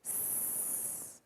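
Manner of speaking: soft unvoiced hiss "s". Shown as a demonstration. Teacher hissing the S sound quietly. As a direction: hiss the "s" like a snake. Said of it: "the hissing sound".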